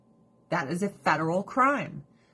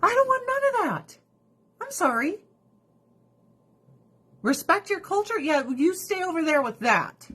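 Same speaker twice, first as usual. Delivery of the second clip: much higher, shocked outburst; outraged; 10 semitones higher than usual. Retold or imitated. Reacting to child marriage, high shocked outrage. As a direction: blurt it out in disgust. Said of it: "And I'm like".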